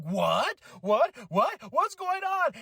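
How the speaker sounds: building surprised questions to the top; mock disbelief. Retelling shock at announcement.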